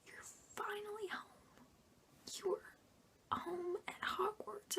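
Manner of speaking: heartfelt. Acted. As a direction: whisper it like a homecoming. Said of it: "I'm breaking my heart while saying this".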